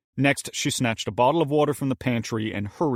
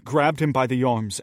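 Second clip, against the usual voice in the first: plain reading voice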